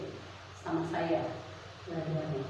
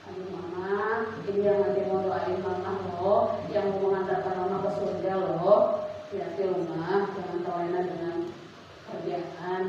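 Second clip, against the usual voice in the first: gentle reminder; 7 semitones lower than usual. Shown as a demonstration. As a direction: coax the mother gently, no blame. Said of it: "Yang penting jangan bilang".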